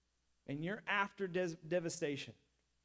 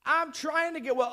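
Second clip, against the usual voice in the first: louder, incredulous protest, a bit higher; mock exasperation; 4 semitones higher, 6 dB louder than usual. The sick man retorting indignantly to Jesus, louder and exasperated. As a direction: act offended that anyone would ask. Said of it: "you're thinking like".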